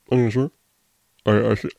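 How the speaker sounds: small, stammering referee imitation; mock confused referee. Referee doubting the host's decision, mock confusion. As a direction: butt in hesitantly like a dithering official. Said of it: "he was so confused, didn't know".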